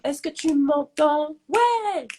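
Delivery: high, slow little voice; tiny squeaky put-on voice; small voice. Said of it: "la petite voix et tout".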